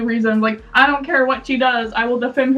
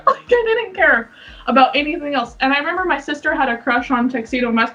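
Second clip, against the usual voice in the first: ordinary delivery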